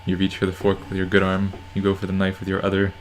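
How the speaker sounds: normal talking voice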